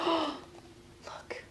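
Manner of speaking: a breathy, hushed look, almost no voice; hushed gasp. Acted. To the kids, a hushed gasp over the picture. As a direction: gasp and breathe the word in awe.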